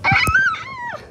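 high-pitched frightened scream. Acted. Kid trapped in the haunted place, screaming. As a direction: shriek in mock terror.

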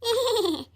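short high scornful laugh; mocking laugh. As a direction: laugh her remark off with scorn. Mother laughs at daughter's jab. (ಹೀಯಾಳಿಸುವ ನಗು)

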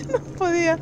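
high, laughing squeal into the words; nervous laughing. Real, not acted. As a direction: laugh helplessly while admitting it. Ríe nerviosa al explicarse. (risa nerviosa y aguda al hablar)

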